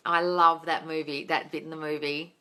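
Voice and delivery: usual speaking voice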